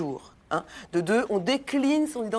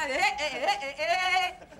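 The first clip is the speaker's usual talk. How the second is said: woodpecker laugh; 9 semitones higher, much slower than usual. Retold or imitated. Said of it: "Woody Woodpecker".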